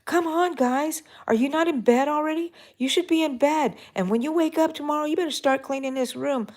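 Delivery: put-on rough mom voice nagging the children; mean mom voice. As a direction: bark at the kids to get to bed. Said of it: "Instead, it was".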